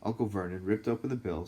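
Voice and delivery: ordinary delivery, nothing marked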